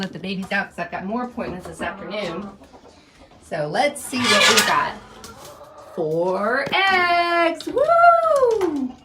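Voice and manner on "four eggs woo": high, loud whoop of delight; thrilled at finding eggs; excited cheer. Real, not acted. Cheering at the four eggs she found, high and loud.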